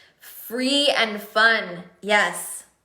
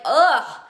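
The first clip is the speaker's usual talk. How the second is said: higher-pitched, acted "ugh" of frustration; exasperated groan; 4 semitones higher, 4 dB louder than usual. Coach on hours lost choosing captions, exasperated groan. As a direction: groan as if fed up with the fuss.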